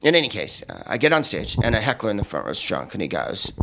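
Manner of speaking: ordinary delivery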